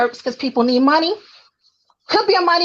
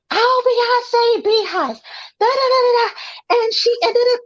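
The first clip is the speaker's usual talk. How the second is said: mocking fan voice; 9 semitones higher than usual. Mocking the typical [name] fan.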